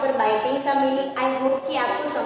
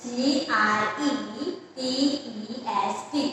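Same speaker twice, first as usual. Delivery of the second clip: slow spelling; 3 dB quieter, much slower than usual. Shown as a demonstration. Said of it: "next word is".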